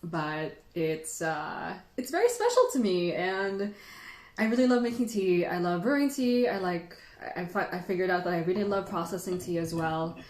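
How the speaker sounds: ordinary delivery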